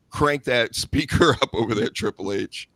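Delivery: usual speaking voice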